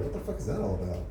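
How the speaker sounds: ordinary talk, nothing marked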